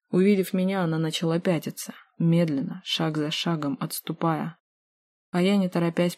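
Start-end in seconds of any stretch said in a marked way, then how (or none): none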